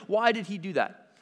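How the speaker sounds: ordinary talk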